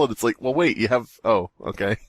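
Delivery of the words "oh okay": ordinary talk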